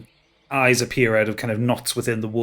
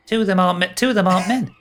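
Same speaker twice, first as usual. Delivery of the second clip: put-on high boyish satyr voice; 8 semitones higher, 3 dB louder, faster than usual. Satyr boy blurts correction.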